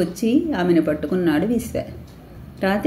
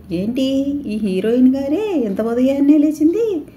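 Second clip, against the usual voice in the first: surprised; 5 semitones higher than usual. Acted. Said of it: "ఆశ్చర్యంగా".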